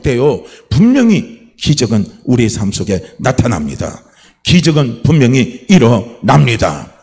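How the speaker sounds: emphatic sermon climax with weighty ending; forceful preaching. Real, not acted. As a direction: drive the promise home, slowing on the last words.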